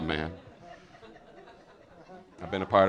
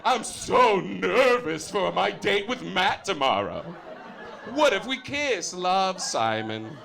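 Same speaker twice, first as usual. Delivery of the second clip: higher, slow, nervous schoolgirl diary voice; mock diary entry; 8 semitones higher, slower than usual. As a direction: read it like a lovesick teen confessing to her diary. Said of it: "being like".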